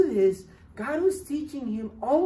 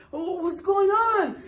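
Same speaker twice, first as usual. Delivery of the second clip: alarmed question; 9 semitones higher, 4 dB louder than usual. Retold or imitated. Hudson opens the door alarmed.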